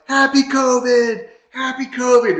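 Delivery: sing-song yell of Happy covid at passersby; retold yelling. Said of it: "I started yelling".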